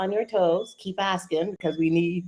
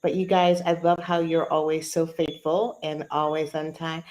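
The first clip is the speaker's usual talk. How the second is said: ordinary delivery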